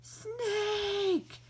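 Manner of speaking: alarmed shout. Acted. Spotting a snake, shouting a high alarm. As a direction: yell the warning.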